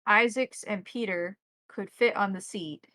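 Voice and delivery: ordinary talk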